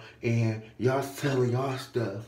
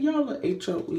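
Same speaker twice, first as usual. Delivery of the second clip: calling them out; 14 semitones higher, 5 dB louder than usual. Retold or imitated. Calls store out.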